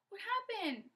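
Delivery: high, loud shocked question; alarmed question. Acted. Father sees the thorn in her eye, alarmed question. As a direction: react with alarm.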